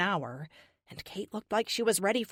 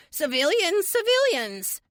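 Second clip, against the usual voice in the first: loud, squeaky-high mimicry; mock high-pitched; 11 semitones higher, 6 dB louder than usual. A narrator's reading. Teammate mocks his leader over comms, mock high-pitched. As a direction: parrot him in a much higher, louder voice. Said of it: "in a mock high-pitched voice".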